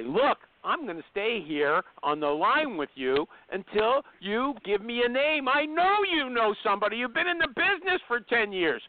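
insistent, building to a high-pitched push; mock pressure that climbs and won't let go; aggressive recruiter. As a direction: badger them like a pushy recruiter on the phone. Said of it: "he's pretty aggressive".

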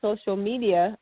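ordinary talk, nothing marked

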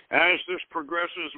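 usual speaking voice

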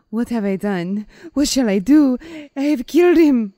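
high, loud hammed-up wail; mock weeping. Wife standing over her husband's body, mock distraught weeping. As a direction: build to a melodramatic, anguished cry. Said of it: "she's weeping and repeating over and over".

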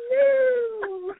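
very high, long-held comic hello; Mrs. Doubtfire. Retold or imitated. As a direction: imitate a hooting falsetto greeting. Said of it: "he's just like".

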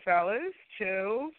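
ordinary talk, nothing marked

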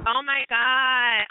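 slow, drawn-out gasp of joy; overwhelmed. Real, not acted. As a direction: let it stretch out, moved. Overwhelmed by listener love.

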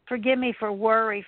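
ordinary delivery

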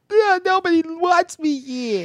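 high, slow self-pitying whine; mock wailing. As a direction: wail it like a rejected drama queen. Mocking character who runs off.

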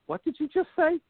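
high-pitched imitation of appalled kids; mock disbelief. Kids react to parent's dialect slip.

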